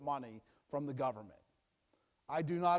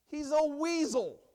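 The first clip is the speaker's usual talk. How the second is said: scornful verdict; 11 semitones higher, 6 dB louder than usual. Real, not acted.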